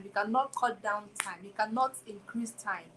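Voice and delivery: ordinary talk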